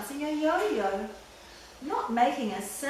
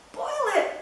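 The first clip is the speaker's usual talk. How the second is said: high, shocked gasp; gasped alarm; 12 semitones higher than usual. A narrator's reading. Horton gasping at the threat of boiling. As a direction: gasp it out in horror. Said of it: "gasped Horton".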